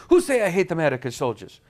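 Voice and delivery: put-on broken English in an accent; Iraqi accent. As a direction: imitate the interpreter. Iraqi interpreter's reply.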